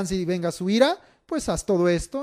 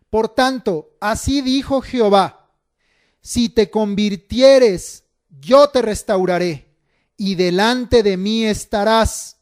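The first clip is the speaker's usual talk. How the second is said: loud, measured, emphatic preacher's reading; Scripture reading; 2 semitones higher, 5 dB louder than usual. Acted. Preacher reads God's promise to the congregation, loud solemn proclamation. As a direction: proclaim the prophecy in drawn-out phrases.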